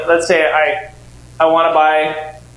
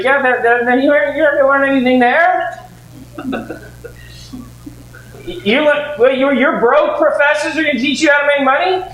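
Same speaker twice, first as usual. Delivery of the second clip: mocking dad; 6 semitones higher than usual. Dad mocking son's plans.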